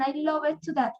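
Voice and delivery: "love it" for loved, E sounded; wrong added syllable. Shown as a demonstration. Teacher demonstrates the mistake then rejects it, extra -ed syllable. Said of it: "No, love".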